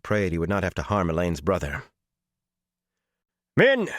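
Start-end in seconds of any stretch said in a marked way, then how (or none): 3.6-3.9 high, loud, tense call; grated call. A narrator's reading. Warrior calls to the woman he would rescue, grated. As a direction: call her name out high and hard. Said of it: "Gawyn grated".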